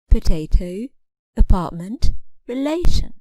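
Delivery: "Potato, apartment, relation" with each middle syllable prominent; middle-syllable stress. Shown as a demonstration. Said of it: "the middle syllable is stressed".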